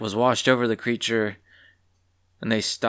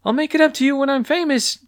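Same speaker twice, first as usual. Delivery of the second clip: much higher, carefree shout over her shoulder; cheeky call back; 4 dB louder, faster than usual. Acted. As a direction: promise as she runs away. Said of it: "she yelled back at the farmer".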